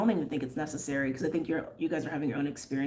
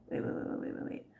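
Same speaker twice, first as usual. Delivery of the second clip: muttered wait; 9 dB quieter, faster than usual. Real, not acted. Stops herself mid-reading.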